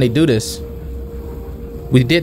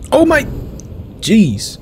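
surprised; 10 semitones higher, much slower than usual. Real, not acted. Surprised at game moment.

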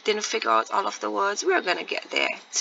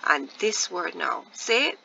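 ordinary talk, nothing marked

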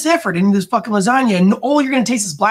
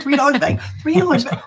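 proud host; 7 semitones higher than usual. Retold or imitated. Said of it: "you're like".

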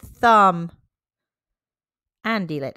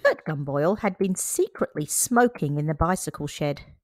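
usual speaking voice

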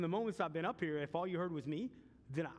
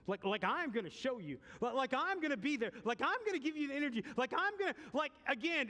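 rising, fervent pulpit preaching; 5 semitones higher than usual. Acted. Pastor preaching to the church, building fervor. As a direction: preach it with mounting fire.

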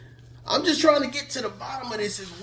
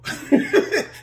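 breathy burst of laughter, louder; 8 semitones higher, 7 dB louder than usual. Real, not acted. Laughing to viewers about unhappy people, airy loud laugh.

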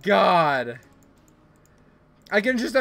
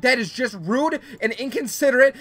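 higher, louder, hammed-up scolding; mock outrage yell; 6 semitones higher, 5 dB louder than usual. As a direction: yell at the cake thief like a mock judge. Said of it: "why are you not yelling about stealing my cake".

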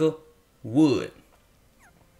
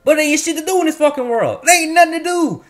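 high, loud ranting yell; real exasperated shout; furious outburst; 11 semitones higher, 6 dB louder than usual. Real, not acted. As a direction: blow up at the game in real frustration. Angry rant about empty world.

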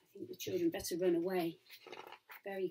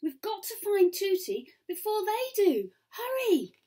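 high, loud call to hurry; urgent urging; 11 semitones higher, 5 dB louder than usual. Acted. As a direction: hurry your friend along anxiously. Girl urges haste.

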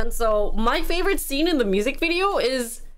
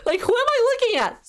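amazed excitement; 12 semitones higher, faster than usual. Real, not acted. Amazed by the rapper.

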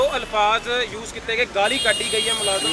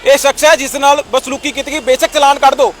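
loud, punchy reporter delivery; forceful TV news read; 3 semitones higher, 7 dB louder than usual. Acted. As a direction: sell the story to the camera, louder.

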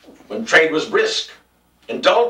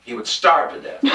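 ordinary delivery, nothing marked

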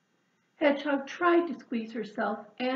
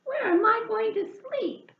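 distressed; 11 semitones higher, 5 dB louder than usual. Acted. Hedgehog distressed.

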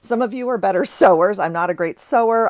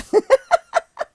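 high laugh; 7 dB louder than usual. Real, not acted. Vlogger laughing at framing the pillowcase. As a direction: burst of high giggling.

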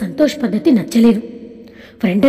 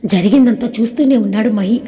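ordinary delivery

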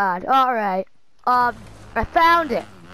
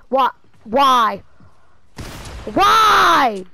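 loud wail, climbing higher and longer; despairing shout; 3 semitones higher, 7 dB louder, much slower than usual. Real, not acted. Gamer despairing over a missing gun, loud wail. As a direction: cry out to the heavens in frustration.

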